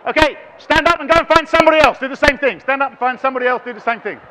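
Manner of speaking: loud, high-pitched shout over a crowd; calling out. Real, not acted. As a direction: shout instructions to a busy room. Calling instructions over noisy audience.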